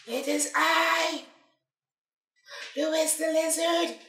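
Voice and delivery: slow, high, raspy little creature; raspy lizard voice. Acted. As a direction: drawl it like a small raspy lizard. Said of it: "A small raspy voice".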